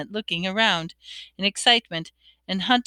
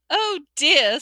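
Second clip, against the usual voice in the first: higher, mildly dismayed sigh; sad; 7 semitones higher, 3 dB louder than usual. A narrator's reading. Hermione watching the ghost flee, sad. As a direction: lament it with sympathetic dismay. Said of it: "said Hermione sadly".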